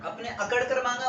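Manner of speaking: ordinary talk, nothing marked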